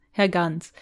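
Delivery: normal talking voice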